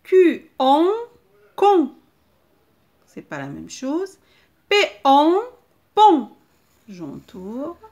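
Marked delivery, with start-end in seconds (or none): 0.0-1.9 loud, high spelling, then the blend; emphatic sounding-out. Shown as a demonstration. Teacher sounds out a syllable for children, emphatically. 4.7-6.3 loud, high spelling, then the blend; emphatic sounding-out. Shown as a demonstration. Teacher sounds out a syllable for children, emphatically.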